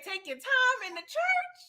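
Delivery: high, loud congregation holler; church encouragement. Retold or imitated. Churchgoer cheering on a singer, high loud holler. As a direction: call it out like a churchgoer urging on a soloist. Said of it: "you spitting when they say".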